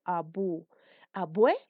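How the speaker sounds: abuelo broken into separate syllables, abu then abuelo; syllables split apart. Shown as a demonstration. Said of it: "lo separo en tres sílabas".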